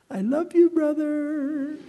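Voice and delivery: put-on high, loud, sugary kid voice; sweet sibling voice. Shown as a demonstration. Kid told to calm down, high sugary voice to brother. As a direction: chirp it like a forced-nice child. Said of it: "all you need the time to do is this".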